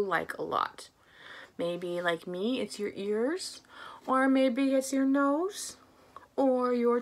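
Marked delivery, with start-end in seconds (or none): none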